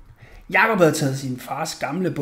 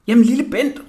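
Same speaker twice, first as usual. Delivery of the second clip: high, raised-voice call; 7 semitones higher, 7 dB louder than usual. A narrator's reading. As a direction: call loudly to the small boy. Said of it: "råbte Jakob".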